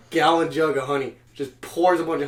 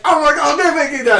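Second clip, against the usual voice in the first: high and loud, hammed-up outrage; mock upset cook; 13 semitones higher, 9 dB louder than usual. Retelling a cook angry at the Koreans, mock outrage. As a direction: play the offended cook, over the top. Retold cook getting mad.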